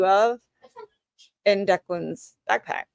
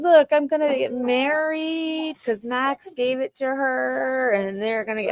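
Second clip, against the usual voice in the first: imitating little girl; 6 semitones higher than usual. Mom imitates daughter bragging about getting married, mock singsong. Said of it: "she was walking around going".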